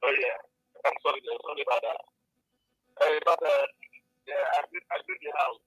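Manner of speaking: faint, distant voice on a phone line; quiet, hard to hear; barely audible. Real, not acted. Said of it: "your voice is very low".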